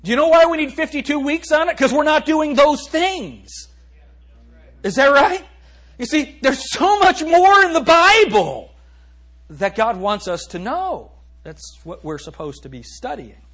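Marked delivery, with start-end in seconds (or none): 0.0-11.1 loud, high, driving preacher's call; preaching at full force. Acted. Pastor preaching hard to the congregation, loud and driving. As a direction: thunder it at the congregation.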